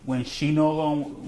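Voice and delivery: slow, drawn-out attempt at the slogan; deliberately slow. Shown as a demonstration. Dad slows his slogan for his son, deliberately slow. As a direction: stretch each word out slowly. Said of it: "I'm gonna say this slow".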